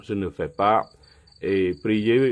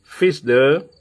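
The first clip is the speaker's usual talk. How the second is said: higher and louder scripture reading from the pulpit; preaching; 4 semitones higher, 5 dB louder than usual. Acted. Pastor reading Acts sixteen aloud to his church, preaching voice. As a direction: proclaim the verses to the church.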